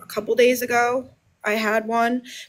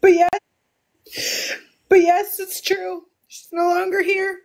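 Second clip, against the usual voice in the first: higher, strained voice fighting back tears; real grief, higher and close to sobbing; near tears; 6 semitones higher than usual. Real, not acted. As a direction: push the words out through crying. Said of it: "Take a breath".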